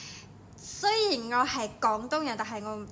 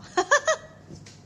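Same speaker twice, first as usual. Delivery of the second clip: short high giggle; quick high flirty giggle; 14 semitones higher, 5 dB louder than usual. Real, not acted. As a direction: giggle at your own flirting. Invites Leos to chat.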